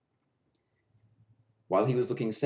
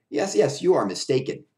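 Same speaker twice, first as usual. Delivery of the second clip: high, louder insistent friend; insisting friend; 5 semitones higher, 5 dB louder than usual. A narrator's reading. Clown friend insists Willie is wrong, cheerful. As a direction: press the point cheerfully.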